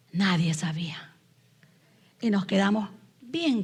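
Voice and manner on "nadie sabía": whispered like a secret; secretive whisper. Retold or imitated. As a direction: breathe it out as a confidence. Secret wedding, nobody knew.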